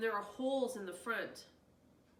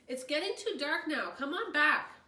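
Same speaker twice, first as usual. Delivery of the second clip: loud, higher call across the yard; 7 semitones higher, 9 dB louder than usual. A narrator's reading. Miss Dickerson calls the kids in at dark, loud. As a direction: call them home.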